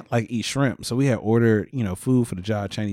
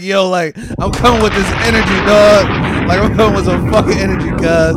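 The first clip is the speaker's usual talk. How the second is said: loud, higher, fired-up delivery; hyped-up retelling; 6 semitones higher, 6 dB louder than usual. Retold or imitated. Retelling a text to coworkers, hyped up and loud. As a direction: act out your pumped-up text with force.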